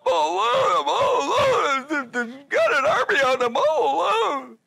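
loud, high, hammed-up wail; whining Elijah. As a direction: wail it out like a self-pitying prophet. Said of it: "he starts whining to the Lord".

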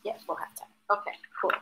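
unvoiced whisper; whispered aside. Real, not acted. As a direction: whisper it off-mic. Aside to someone nearby.

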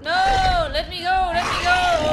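loud, high cries of alarm; drawn-out, desperate shouts; panicked yelling. Real, not acted. Grabbed by enemy in game.

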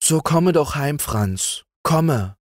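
mother calling. A narrator's reading. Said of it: "unter dem Schleier hervorrief".

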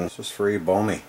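ordinary talk

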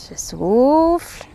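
long, drawn-out whispered exhale; exhaling while speaking. Shown as a demonstration. Instructor demonstrating the out-breath in an exercise, whispered exhale. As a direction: let the words ride out slowly on the breath.

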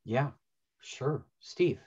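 usual speaking voice

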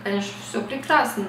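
ordinary delivery

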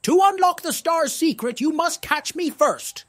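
playful taunt, opening high and loud; mischievous tease. Acted. Fish teases the heroes to catch it, playful. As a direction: dare them to catch you. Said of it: "Sparkle teased".

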